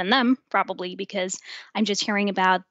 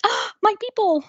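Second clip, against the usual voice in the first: high, delighted exclamation; 13 semitones higher than usual. Retold or imitated. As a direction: gush with delight. Delighted realisation quoted.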